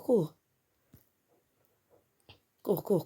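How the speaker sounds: high, urgent, repetitive cries; frantic panic. Real, not acted. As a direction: plead desperately to save a friend. Friend dying, urges rescue. (panique frénétique, voix aiguë)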